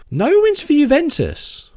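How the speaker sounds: can't believe what you're reading; stunned, high-pitched outburst at the stats; shocked disbelief. Real, not acted. Shocked reading club history.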